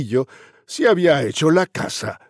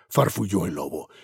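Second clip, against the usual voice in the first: ordinary delivery, nothing marked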